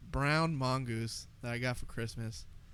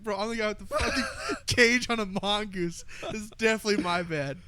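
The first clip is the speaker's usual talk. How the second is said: laughing; 8 semitones higher, 6 dB louder than usual. Retold or imitated. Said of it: "I was like".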